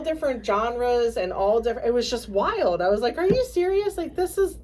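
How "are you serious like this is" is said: much higher, disbelieving retelling; incredulous. Retold or imitated. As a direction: retell it as if you can't believe it. Reacting in disbelief to songs.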